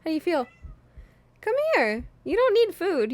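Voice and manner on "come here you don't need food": high pet-scolding voice. Real, not acted. Owner scolding her hungry cat in high baby talk. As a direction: tell the cat off in a squeaky high voice.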